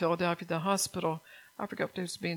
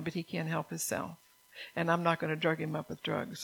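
ordinary talk, nothing marked

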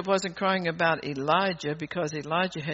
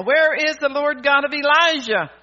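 full-force preaching shout, louder and higher; 7 semitones higher, 10 dB louder than usual. Shown as a demonstration. Preacher shouting the question for the congregation to copy, loud. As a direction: shout it out like a revival cry. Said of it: "You ought to be shouting that tonight".

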